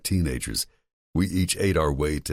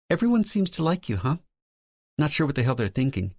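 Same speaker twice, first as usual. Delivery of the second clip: higher, lightly voiced woman; female character voice; 12 semitones higher than usual. A narrator's reading. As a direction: play Lauren, higher pitch.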